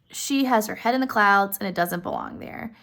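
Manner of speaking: ordinary talk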